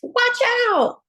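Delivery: high, loud warning shout; excited call. A narrator's reading. Narrator warning the reader as cool beans arrive, loud.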